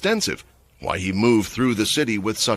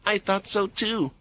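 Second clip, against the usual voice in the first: sweetly; 10 semitones higher, 5 dB quieter than usual. A narrator's reading. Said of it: "Mia replied sweetly".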